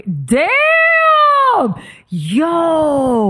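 high drawn-out shout; excited shout. Real, not acted. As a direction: yell it out, stretched slowly.

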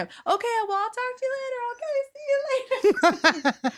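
high, soft, distant phone voice; far-off voice on the receiver. Retold or imitated. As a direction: sound tiny and far from the receiver. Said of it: "They're like, I can hear".

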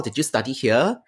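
ordinary talk

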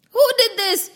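high, loud who did this; angry demand. A narrator's reading. Gopi auntie demanding who made the mess. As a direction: demand an answer.

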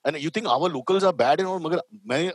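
ordinary talk

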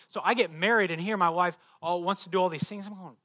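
ordinary talk

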